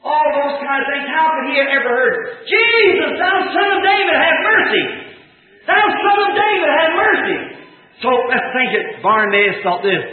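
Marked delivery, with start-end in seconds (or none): 2.5-7.4 desperate cry. Retold or imitated. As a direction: call out over the crowd, begging for mercy. Blind beggar crying out to Jesus.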